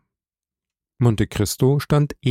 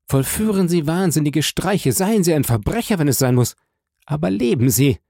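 higher and higher, urging him on; rising urgent appeal; 6 semitones higher than usual. Acted. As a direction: plead with growing fervour for him to live. Count urges Morel to live.

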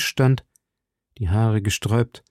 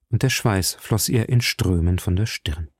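usual speaking voice

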